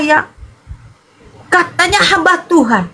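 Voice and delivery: normal talking voice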